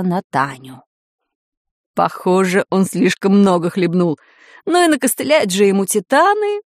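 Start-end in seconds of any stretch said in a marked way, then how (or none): none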